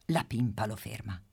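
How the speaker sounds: usual speaking voice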